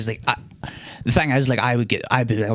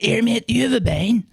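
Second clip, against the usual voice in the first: high mock voice of a drunk stranger; imitating stranger; 11 semitones higher than usual. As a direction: play the odd man on the platform, pitched up. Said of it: "He was like".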